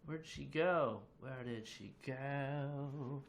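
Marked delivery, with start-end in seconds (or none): 1.2-3.2 slow, stretched-out playful question; drawn-out wondering. Pretend. Streamer looking for a vanished viewer, drawn-out wondering. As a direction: draw out the last word long.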